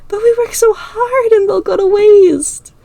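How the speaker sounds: put-on high, slow, tearful girl; sniffling whine. A narrator's reading. Ira crying over the salty cookies, high and tearful. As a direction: whimper it while sniffling.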